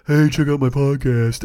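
slow, drawn-out cheesy plug; put-on, stretched-out sales pitch; mock self-plug. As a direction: parody yourself pushing your show. Mocks himself plugging his show.

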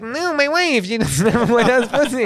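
ridiculing the pundit as he defends his wife; mocking Ben Shapiro. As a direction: squeak out a defensive correction. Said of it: "And then he's like".

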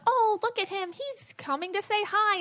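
mocking a keeper cooing at an animal; mock doting keeper. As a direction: mimic a sappy zookeeper gushing. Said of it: "but they're like".

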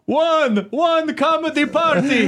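very high, drawn-out counting voice; counting character impression. Mocking a friend's impression as a counting character, hammed-up voice. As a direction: count grandly in a slow, high accent. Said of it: "it almost sounded like".

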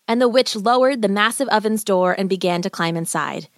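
usual speaking voice

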